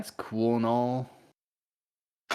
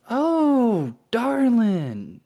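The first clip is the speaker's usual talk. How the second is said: seductive succubus; 7 dB louder than usual. Acted. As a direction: coo it high, like a temptress. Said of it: "And she goes".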